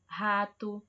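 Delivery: rato with a throaty h-like R; strong R. Shown as a demonstration. Teacher showing the strong R in rato, clearly. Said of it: "o R tá saindo com som forte".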